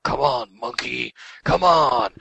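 re-enacted game trash-talk shout; retold yelling. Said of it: "And I'm yelling out".

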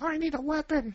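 high squeaky talking doll voice; pull string toy. Retold or imitated. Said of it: "you pull the string back and it goes".

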